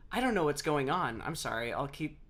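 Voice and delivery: ordinary delivery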